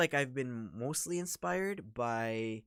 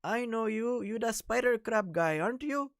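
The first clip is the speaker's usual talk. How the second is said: much higher and louder imagined scammer; pitched-up, loud mock caller voice; 12 semitones higher, 9 dB louder than usual. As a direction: squeal it like a caller who just recognized you. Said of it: "a future scammer who goes".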